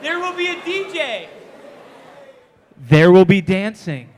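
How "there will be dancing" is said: low, loud, slow announcer; deep announcer voice. Acted. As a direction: boom it like a hype man. Hyping the party.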